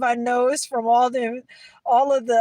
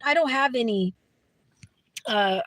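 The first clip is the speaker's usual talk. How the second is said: ordinary talk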